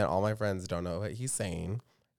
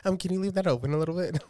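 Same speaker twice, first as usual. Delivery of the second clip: higher-pitched, tentative request; put-on high voice, politely pleading; imitating co-host; 8 semitones higher, 3 dB louder than usual. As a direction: mimic a nervous friend asking a small favor. Imitates claustrophobic co-host asking timidly.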